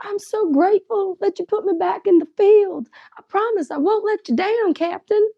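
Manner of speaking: high-pitched mock gratitude; hammed-up earnest rookie; eager underling impression. Mock eager marshal thanking boss.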